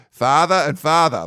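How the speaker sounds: loud, slow Boston accent, R's dropped. Shown as a demonstration. Recalling Massachusetts school synonyms lesson, Boston accent. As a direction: imitate Massachusetts schoolmates saying both words alike. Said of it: "horrible Boston accent".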